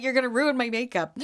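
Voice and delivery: normal talking voice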